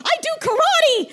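high, huffy diva pig voice; furious Miss Piggy. Acted. Miss Piggy scolding the storyteller, high and huffy. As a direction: let the offended diva rip.

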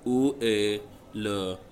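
slow, halting tourist French; deliberately bad French phrasebook voice. Shown as a demonstration. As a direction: mangle the French on purpose. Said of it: "in really, really bad French".

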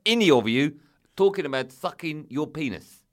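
loud, high mock South African outrage, easing off; South African accent. Agent banning the whole subject, outraged South African accent. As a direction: bellow it as the South African agent, then come down. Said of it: "I'll do the accent".

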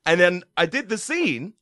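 usual speaking voice

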